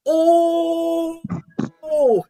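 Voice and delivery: high, loud shocked "oh"; shocked gasp. Real, not acted. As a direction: gasp out a stunned oh. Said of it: "And I'm just like".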